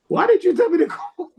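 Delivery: high, laughing question. Retold or imitated. DJ laughing at the caller's bad idea. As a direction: ask it through laughter. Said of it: "She was like".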